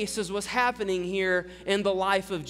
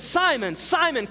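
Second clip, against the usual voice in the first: higher-pitched, urgent summons by name; calling to attention; 6 semitones higher, 3 dB louder than usual. Retold or imitated. Jesus calls Simon to attention, insistent summons. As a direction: call the name twice to make him listen. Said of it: "calls him to attention".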